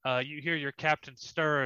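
normal talking voice

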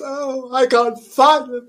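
high, wailing ghostly moan; a hammed-up wailing spectre searching for his family. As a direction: moan like a lost Tudor ghost. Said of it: "He moans, he moans".

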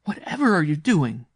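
loud, high, shocked question; crying out. A narrator's reading. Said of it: "she cried".

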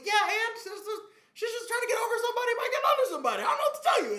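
high, fast excited quote; eager self-quote. Retold or imitated. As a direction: pitch up and rush the excuse. Quoting his own eager self.

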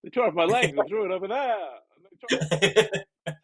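high loud scarecrow voice, rushing; pumpkin head voice. Acted. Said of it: "You sound like the Scarecrow from The Wizard of Oz".

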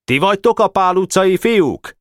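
high, projected question; calling in. A narrator's reading. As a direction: call it in from the doorway. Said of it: "bekiáltott".

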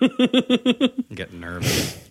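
very slow, soft nervous drawl; mock nervous. Reacting to Dalton, a slow mock nervous drawl. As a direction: stretch it out as if sweating.

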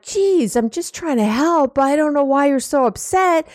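high-pitched mock defensive whine; a hammed-up exasperated pushback; defensive exasperation. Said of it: "the more you're like".

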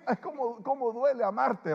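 high, loud mock groan of pain. Preacher joking about a painful bear hug, high loud mock whine. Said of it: "mock pain after the hug".

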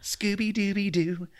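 high, drawn-out cartoon dog catchphrase; slow, sing-out mascot impression; Scooby-Doo. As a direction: do a goofy cartoon dog call. Imitates the cartoon dog's catchphrase.

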